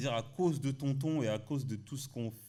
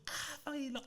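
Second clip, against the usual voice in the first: very high, light mock fan squeal; mock fan frenzy; 13 semitones higher, 7 dB quieter, much faster than usual. Showing the crowd frenzy that didn't happen, high mock squeal. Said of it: "il n'y a pas eu en mode".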